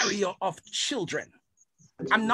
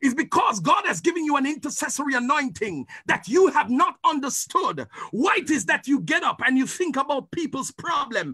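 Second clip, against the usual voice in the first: fiery preaching; 2 semitones lower than usual. Acted. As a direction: preach it with full force. Preaching at full force.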